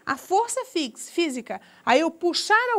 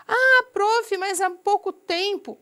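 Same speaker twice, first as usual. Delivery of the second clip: high, loud whiny student protest; imitating a student; 5 semitones higher, 4 dB louder, slower than usual. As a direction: mimic a pupil complaining to the teacher. Teacher voices a student objecting. (imitando um aluno reclamando, voz aguda)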